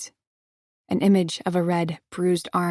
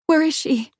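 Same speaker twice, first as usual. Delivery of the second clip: high, loud demand; demanding shout; 9 semitones higher, 6 dB louder than usual. Acted. Heroine demanding where Nicole is, loud. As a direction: demand it from him.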